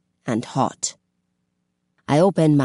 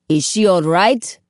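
calling out; 4 semitones higher, 4 dB louder than usual. A narrator's reading. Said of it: "I call above the roaring water".

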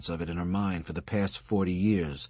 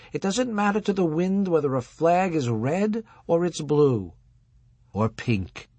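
raised, lecturing rabbi voice; old rabbi voice; 9 semitones higher than usual. Acted. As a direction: preach it like an aging rabbi. Husband rabbi voiced higher.

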